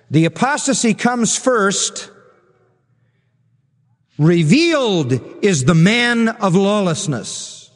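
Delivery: slow reading with "Revealed" set apart after a long pause; emphatic verse reading. Shown as a demonstration. Preacher showing the verb's emphatic position, stress on REVEALED. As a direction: stretch "Revealed" out as the key word. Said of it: "stands in the emphatic position".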